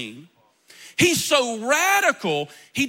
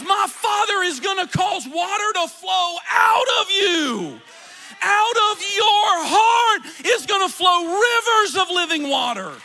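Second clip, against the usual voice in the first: high, loud impassioned cry; proclaiming; 6 semitones higher, 4 dB louder than usual. Retold or imitated. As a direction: preach it out with full fervour. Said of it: "He says".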